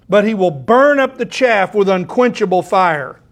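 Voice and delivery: loud fiery scripture reading; preacher declaring John's warning, loud and fervent; full-force preaching. Acted. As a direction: proclaim judgment from the pulpit.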